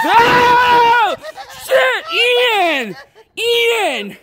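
drawn-out high shout of shock; frightened screaming. Real, not acted. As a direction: scream at the friend who scared you. Friend scares him a second time.

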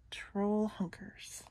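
hushed, breathy narration; whispered. A narrator's reading. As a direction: whisper it like a secret lurking. Narrator hushes for lurking troll.

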